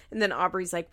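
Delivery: usual speaking voice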